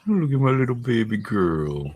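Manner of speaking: high, sing-song puppy talk. Real, not acted. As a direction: owner cooing to sleepy puppies. Baby talk to puppies.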